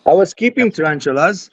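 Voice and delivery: ordinary delivery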